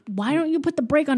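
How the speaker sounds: high, quick retold accusation; mock bickering. Host retells dad and son fighting over brake, mock bickering. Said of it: "still yelling at each other".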